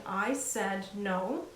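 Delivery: slow, deliberate "I said no."; firm slow no. Shown as a demonstration. Mother to daughter at door, slow firm no. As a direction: set the boundary slowly and firmly. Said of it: "create that boundary and saying".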